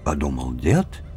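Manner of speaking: usual speaking voice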